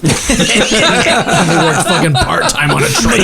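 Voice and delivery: slow, laughing punchline. Real, not acted. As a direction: wheeze out the joke through laughter. A joke told while laughing.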